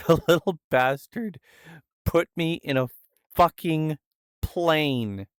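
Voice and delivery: mock outrage. Real, not acted. Streamer recalling his buddy's plane prank, mock outrage. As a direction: punch out the swearing, half laughing.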